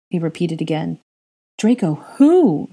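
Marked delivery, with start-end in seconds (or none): 1.6-2.7 higher, louder, slow demand; wife demanding the name, higher, louder and slow. A narrator's reading. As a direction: insist on the answer, pressing hard. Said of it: "she demanded".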